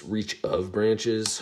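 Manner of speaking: ordinary talk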